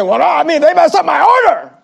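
high, loud petty complaint; whiny complainer. Retold or imitated. Mister Chicken Fingers griping at the pastor about his order. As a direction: whine about your lost order. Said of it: "Mister Chicken Fingers".

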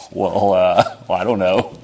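unsure listener. Retold or imitated. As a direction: hem and haw like a doubtful stranger. Hesitant listener in acted exchange.

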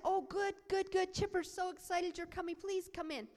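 high-pitched, eager mother; acted excited welcome. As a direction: gush like a mother ushering in an awaited guest.

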